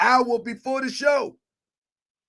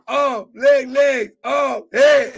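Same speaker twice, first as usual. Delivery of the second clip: excited shout; 4 semitones higher, 8 dB louder, much slower than usual. Real, not acted. Host shouting along, laughing.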